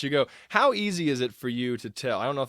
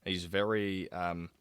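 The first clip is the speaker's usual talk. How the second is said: very slow, low, softer Aussie delivery; Graham Oppy; 4 semitones lower, 7 dB quieter, much slower than usual. Retold or imitated. Host imitating a slow Australian speaker, unhurried accented delivery. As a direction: drag it out, in no rush at all. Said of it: "very slow".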